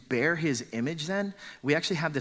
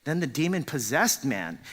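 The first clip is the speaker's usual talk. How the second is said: ordinary talk, nothing marked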